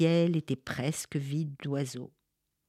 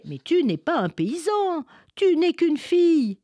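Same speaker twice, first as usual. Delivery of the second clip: with a big smile; 13 semitones higher, 6 dB louder than usual. Acted. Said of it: "avec un grand sourire".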